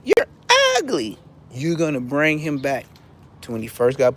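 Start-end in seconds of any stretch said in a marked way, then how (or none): 0.0-1.2 high-pitched mocking call; say it just like that. Shown as a demonstration. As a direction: squeal it high, then let it drop. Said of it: "Just say".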